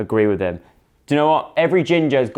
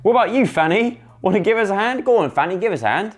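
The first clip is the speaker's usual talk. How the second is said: higher, upbeat character voice; game character coaxing Fanny; 10 semitones higher than usual. Acted. Character asking Fanny to lend a hand, upbeat coaxing. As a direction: call her over to help, pitched up.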